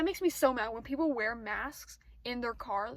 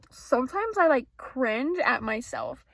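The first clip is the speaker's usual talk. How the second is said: exasperated; 12 dB louder, slower than usual. Real, not acted. Loud slow confession.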